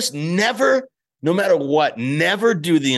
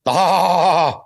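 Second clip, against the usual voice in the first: exclamation; 8 semitones higher, 4 dB louder than usual. Acted. Pacino-style outburst joking.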